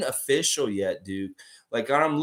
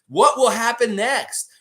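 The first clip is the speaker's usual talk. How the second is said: higher, louder, slow teaser voice; dramatic cliffhanger; 8 semitones higher, 6 dB louder, slower than usual. Acted. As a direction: tease the next episode like a TV narrator. Cliffhanger ending of mascot story.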